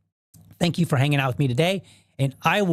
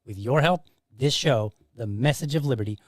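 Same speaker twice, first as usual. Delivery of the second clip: softer, steady sponsor read for the podcast; 5 dB quieter than usual. Acted. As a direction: read the promo lines quietly and smoothly.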